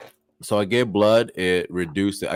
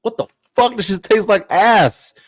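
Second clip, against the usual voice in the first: loud, high-pitched yelp of revulsion; hammed-up squawk of outrage; outraged disgust; 12 semitones higher, 8 dB louder than usual. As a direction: act out shocked disgust at a foul bite. Joke character disgusted by the bite.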